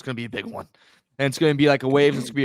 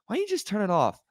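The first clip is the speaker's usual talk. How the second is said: higher mock voice of an annoying critic; 5 semitones higher than usual. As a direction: ape the know-it-all who says just turn it off. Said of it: "I hate when people say".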